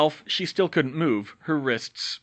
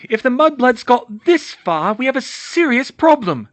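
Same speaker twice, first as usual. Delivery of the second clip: manic warning; 12 semitones higher, 9 dB louder than usual. Acted. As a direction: lean in and threaten. Bellatrix warning Lucius.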